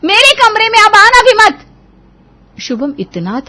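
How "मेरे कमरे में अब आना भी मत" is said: high, loud angry shout; enraged wife yelling; furious. Acted. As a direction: banish him from the room. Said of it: "गुस्से में श्रुति".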